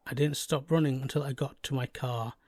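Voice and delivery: ordinary delivery, nothing marked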